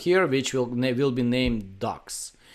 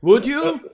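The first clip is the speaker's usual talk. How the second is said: Would you? with the pitch rising much higher and louder; rising intonation; 13 semitones higher, 7 dB louder than usual. Shown as a demonstration. Showing the British rising tune to his host, high. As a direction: sing the question up at the end. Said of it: "I like this intonation going up".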